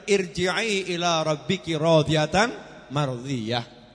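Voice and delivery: slow chanted Arabic verse; slow recitation of the Quran verse. Acted. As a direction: intone the verse slowly, reverently.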